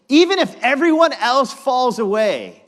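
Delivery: loud, high boastful vow; bravado. Acted. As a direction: puff up like a braggart. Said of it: "don't you love this bravado".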